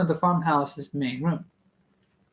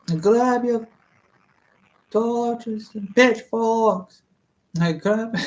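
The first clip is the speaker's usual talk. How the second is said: mock rallying call; 6 semitones higher, slower than usual. Mock riot call mid-reading.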